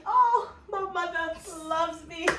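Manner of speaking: high, joyful relief; relieved gush. Retold or imitated. Relief after mother's hug.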